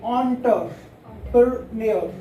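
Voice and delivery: एंटरप्रेन्योर slowly in chunks, on-ter, per, nyor; correct pronunciation. Shown as a demonstration. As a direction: model एंटरप्रेन्योर piece by piece, starting "on". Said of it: "ये इसको बोलते हैं".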